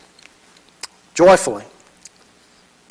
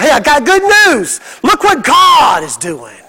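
excited neighbor; 5 semitones higher than usual. Retold or imitated. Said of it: "she comes back and goes".

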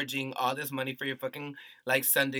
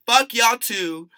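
loud, raised, slow curse; angry dismissal; 7 semitones higher, 9 dB louder, slower than usual. Real, not acted. Podcaster cursing out fans who still listen, angry. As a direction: land each word slowly and forcefully.

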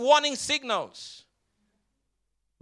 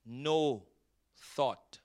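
each word alone, slowly, softer; slow and spaced; 9 semitones lower, 10 dB quieter, much slower than usual. Shown as a demonstration. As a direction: drop every word one by one, quietly. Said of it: "Jesus said".

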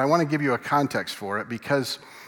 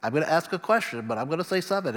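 lightly voiced kid seeking approval; eager kid. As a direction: play a keen pupil at the front of class. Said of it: "He's the kid at the front going".

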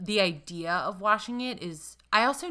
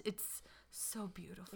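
whisper; 19 dB quieter than usual. Shown as a demonstration. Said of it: "mock swoon after the accent".

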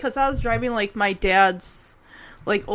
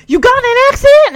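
alarmed dad; 12 semitones higher, 13 dB louder, much faster than usual. Retold or imitated. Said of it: "he's like".

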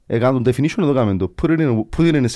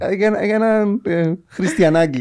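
mock diminutive; 8 semitones higher than usual. Shown as a demonstration. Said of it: "άμα θέλει να πεις αστεία".

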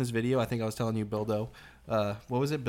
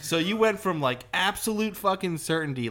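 higher, louder, slower disbelieving jab; incredulous mockery; 7 semitones higher, 4 dB louder than usual. Telling friends how he reacted to the video, incredulous and mocking. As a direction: call out the backpedal in mock outrage. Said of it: "And I'm like".

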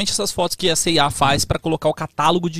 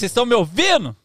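raised, higher voice checking sound; to someone hard of hearing; 9 semitones higher than usual. Real, not acted. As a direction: call out to be heard. Mic check, audience can't hear.

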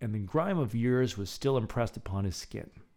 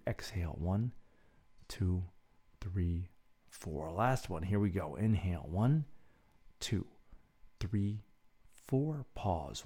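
guided breathing; 3 semitones lower, 5 dB quieter than usual. Acted. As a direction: count the breaths calmly and gently. Leads a breathing exercise.

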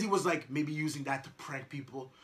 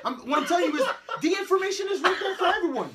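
laughing; 10 semitones higher, 8 dB louder than usual. Real, not acted. Laughing while making his point.

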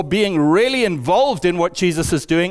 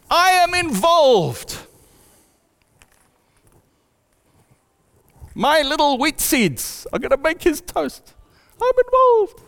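a tiny wheat plant boasting that it is involved; proud little wheat; 10 semitones higher than usual. Acted. Preacher voicing the wheat in a farm parable, high and proud.

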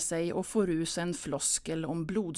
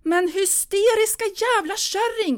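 much higher, louder furious yell; outburst; 9 dB louder than usual. Acted. Pia lashing out at her mother: furious loud yell. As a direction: explode at her.

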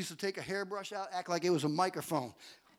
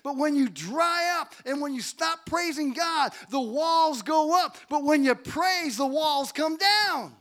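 raised, driving sermon voice; full-force preaching; 4 semitones higher, 6 dB louder than usual. Real, not acted. Preacher urging the church to keep praising, full-force preaching. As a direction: build to a loud, punched climax.

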